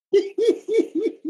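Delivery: sudden high laugh; high burst of laughter. Real, not acted. Laughing at headline.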